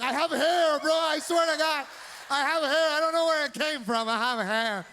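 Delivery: high-pitched, insistent cousin; freaked-out cousin. Retold or imitated. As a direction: blurt it high, swearing it's true. Acting out a panicked cousin.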